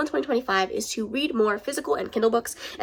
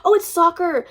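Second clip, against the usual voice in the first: surprised; 8 semitones higher, 6 dB louder than usual. Real, not acted. Realizes book cover shows soccer.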